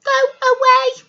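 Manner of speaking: high, loud go away; rude shout. Acted. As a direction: shoo him off loudly.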